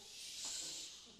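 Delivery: shh, a long unvoiced hiss; sh sound. Shown as a demonstration. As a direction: hold the sound shh for the kids. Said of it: "She is".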